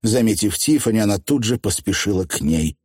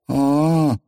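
long, high "ah"; lingering, noncommittal sound; drawn-out; 8 semitones higher than usual. A narrator's reading. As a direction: stretch the syllable out. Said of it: "протянула Тиффани".

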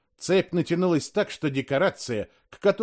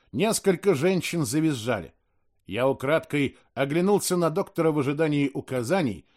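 ordinary delivery, nothing marked

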